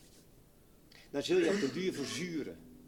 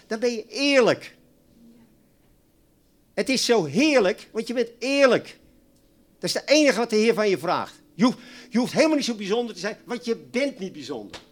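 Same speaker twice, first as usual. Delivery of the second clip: loud, fired-up sermon; full-force preaching; 4 semitones higher, 10 dB louder than usual. Acted. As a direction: hammer it home to the congregation. (vurig preken, luid)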